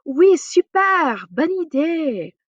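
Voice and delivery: high, loud, slow, eager; enthusiastic yes. Shown as a demonstration. Teacher acts an enthusiastic French yes, high and slow. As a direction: sound thrilled by the idea.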